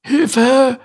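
high, loud strangled cry; high loud choked croak. Acted. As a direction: croak for help with a rope round the neck. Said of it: "krächzte er".

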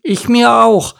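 high, loud duckling retort. Acted. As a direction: pipe back loudly at the parents. Duckling retorts to scolding parents.

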